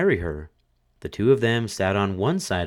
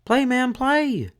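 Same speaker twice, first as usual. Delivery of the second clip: much higher, slow frantic widow cry; 4 dB louder, slower than usual. A narrator's reading. Widow crying to the fiddler, much higher and slow. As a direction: egg him on wildly. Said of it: "cried the widow".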